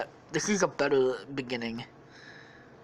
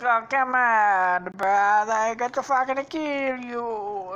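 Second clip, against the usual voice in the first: high, loud, drawn-out protest; Luigi; 7 semitones higher, 5 dB louder, slower than usual. Acted. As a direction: whine slowly in a cartoon voice. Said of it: "then he's like".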